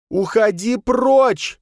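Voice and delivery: slow, high, loud banishing shout; high drawn-out scream of refusal; piercing yell. A narrator's reading. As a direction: shriek it, stretching the words. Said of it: "пронзительно завопила".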